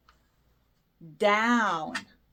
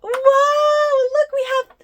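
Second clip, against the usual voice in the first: high, loud joyful wow; big cheer for the toddlers; excited shout; 8 semitones higher, 8 dB louder than usual. Acted. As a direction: shout with delight for the kids. Two balls dropped, host cheers.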